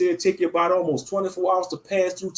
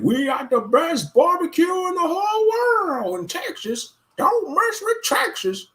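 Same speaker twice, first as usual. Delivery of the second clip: high, put-on braggart Texan voice, slowing on the last line; hammed-up proud Texan boasting, high and slow at the end; mock Texan. Imitating Texans bragging about barbecue, high mock-Texan voice. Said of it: "They probably be like".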